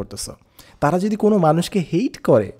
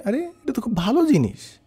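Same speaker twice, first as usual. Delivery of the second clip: much higher-pitched mock reaction; imagined approving reaction; 8 semitones higher than usual. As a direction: voice a listener's naive approval, pitched up. Voices listener's naive objection. (কল্পিত শ্রোতার উঁচু স্বরে সায়)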